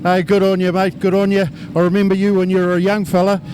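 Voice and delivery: normal talking voice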